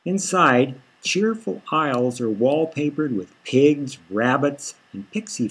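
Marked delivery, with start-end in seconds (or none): none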